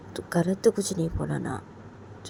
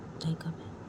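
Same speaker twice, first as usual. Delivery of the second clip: whispered echo; 8 dB quieter than usual. Acted. Whispering a compliment to her, tender. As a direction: repeat it in a soft whisper.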